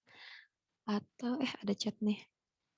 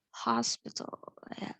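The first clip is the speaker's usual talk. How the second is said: whispered hospital and great; whispering; 9 dB quieter than usual. Real, not acted. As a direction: breathe the words out unvoiced. Whispered aside.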